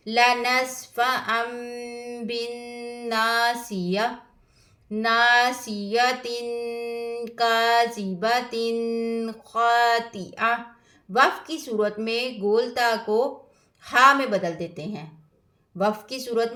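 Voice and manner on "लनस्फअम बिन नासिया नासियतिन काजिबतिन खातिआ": slow, drawn-out melodic recitation; Quran recitation. Acted. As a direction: chant each verse with tajweed. (धीमी क़ुरआन तिलावत)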